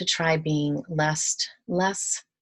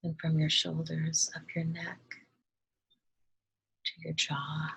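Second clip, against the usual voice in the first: calm, soft meditation voice with long pauses; unhurried, hushed instructions, leaving space to breathe; guided meditation; 5 dB quieter than usual. Acted. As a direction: gently guide listeners through relaxing each body part.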